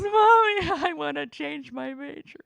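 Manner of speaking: mock tearful daughter, half laughing; a daughter phoning her mom, in a mock teary childlike voice; imitating crying daughter. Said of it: "She calls me crying and goes".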